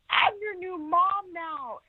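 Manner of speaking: high, slow, sing-song mock of an overeager mother; gushing new mom. Mocking her adoptive mother's airport greeting, high and drawn out. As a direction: stretch it out high like a syrupy greeting.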